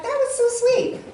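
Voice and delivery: high, touched gushing. Retold or imitated. Speaker recalls her thought on cousin's text, touched.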